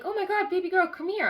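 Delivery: acted-out fussing over a crying little one; cooing comfort. To her crying inner child, high cooing comfort.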